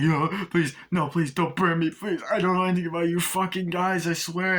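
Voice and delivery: high, pleading cop voice; imitated pleading. The cop begging the torturer not to burn him, high pleading. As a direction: beg high and fast, desperate.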